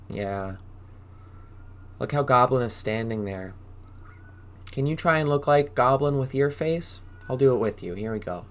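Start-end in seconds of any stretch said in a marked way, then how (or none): none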